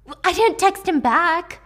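Libby whining back at Avery; defensive sister. Acted. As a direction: protest like a hurt younger sister.

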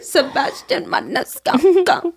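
slow, laughing New York Italian take on Maniscalco. Retold or imitated. Joking about her uncle, New York Italian accent. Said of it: "now it's a joke".

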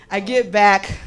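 ordinary delivery

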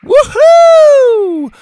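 very high, loud whoop of joy. Acted. Zeta swinging through an obstacle, whooping loudly. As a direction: holler it like swinging through easily. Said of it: "whooping and hollering".

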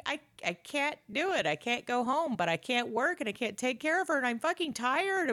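mock whiny Sylvia. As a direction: complain like an overwhelmed mom.

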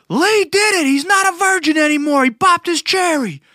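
high, loud hammed-up New York holler; New York scream. Shepherd proclaiming the learner's success, mock New York scream. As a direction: scream it like an excited New Yorker announcing big news. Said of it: "screaming with a New, New York accent".

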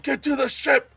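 captain calling out. Acted. Game master voices the captain ordering everyone to the ship, shouting. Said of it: "Captain Reveda calls out".

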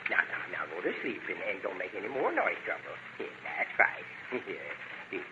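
soft lullaby murmur. Acted. Settling the dog to sleep.

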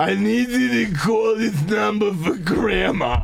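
put-on slow insistent old grandma. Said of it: "doing my own grandma voice".

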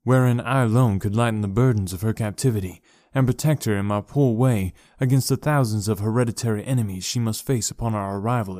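measured audiobook narration; story narration. A narrator's reading. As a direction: read it like a classic novel.

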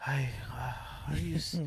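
halting imitated whisper; whispered word-searching. Passenger hunting for the English word, halting whisper. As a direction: grope for the word under your breath, whispering.

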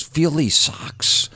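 proud Dice. Retold or imitated. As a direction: savor every word like a proud collector. Dice proudly showing socks.

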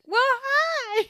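very high, loud cat voice; snooty bored cat. Retold or imitated. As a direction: chirp it like a smug cat. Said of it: "then the cat said".

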